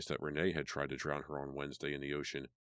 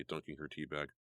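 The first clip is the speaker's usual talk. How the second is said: ordinary delivery, nothing marked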